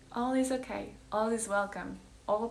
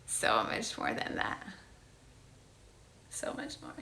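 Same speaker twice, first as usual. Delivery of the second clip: whispered affirmation; 11 semitones lower than usual. Acted. Telling friend she's more, in a whisper. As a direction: breathe it like a secret.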